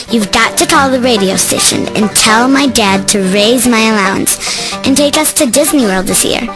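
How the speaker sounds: ordinary talk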